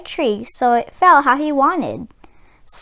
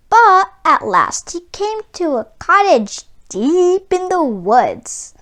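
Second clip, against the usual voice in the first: plain reading voice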